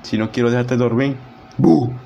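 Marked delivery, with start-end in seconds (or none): 1.6-1.9 sudden loud boo, half joking; mock scare. Streamer booing the viewer as a joke, a playful loud boo. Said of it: "No, mentira".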